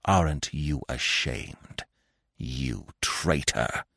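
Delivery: low, slow, soft growl; restrained, embittered growl. A narrator's reading. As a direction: growl it bitterly, held back. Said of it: "In a completely altered voice, restrained and embittered, he growled".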